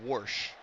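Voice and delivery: "wash" with an added R, "warsh"; Pittsburgh "warsh". Shown as a demonstration. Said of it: "decided that that should be pronunciated".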